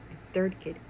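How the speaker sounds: plain reading voice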